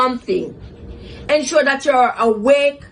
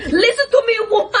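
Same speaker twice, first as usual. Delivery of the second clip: angry retort; 9 semitones higher, faster than usual. Retold or imitated. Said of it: "but I was angry".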